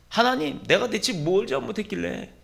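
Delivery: high-pitched aggrieved protest; indignant complaint aimed up at heaven; protesting to God. Acted. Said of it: "항변하는 거예요".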